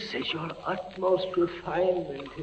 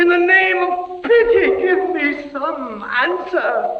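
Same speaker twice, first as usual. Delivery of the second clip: high, loud, slow begging; desperate plea; 8 dB louder, slower than usual. Acted. Prisoner begging inquisitor for an answer, desperate plea. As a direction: beg for an answer in terror.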